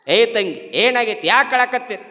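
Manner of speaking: loud concerned questioning. Retold or imitated. Village elder asks crying women what happened, loudly questioning. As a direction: call out urgent questions.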